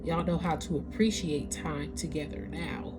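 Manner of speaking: ordinary delivery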